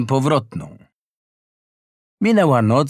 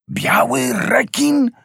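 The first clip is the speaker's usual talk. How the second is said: much higher, slow, stretched gasp of a question; horrified cry; 11 semitones higher, slower than usual. A narrator's reading. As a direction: recoil in horror at the name. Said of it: "krzyknął przerażony rybak".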